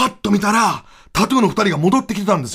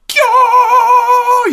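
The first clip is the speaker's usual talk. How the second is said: very high, loud, drawn-out "kyo"; high stretched scream; 6 dB louder, much slower than usual. Retold or imitated. The duo screaming after the swap. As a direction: let out one long shrill yelp.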